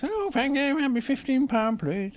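a touch of a polite desk lady; receptionist. Acted. Receptionist in bear story.

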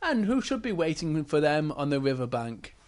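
plain reading voice

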